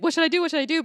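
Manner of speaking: high, loud panicky repeat; mock frantic. Imagining Mel calling friends, loud frantic pleading. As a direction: play the frantic traveller. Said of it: "expecting Mel to be very frantic".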